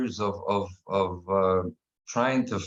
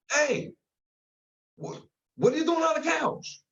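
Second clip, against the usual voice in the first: alarmed call. Retold or imitated. Alarmed father at daughter on couch.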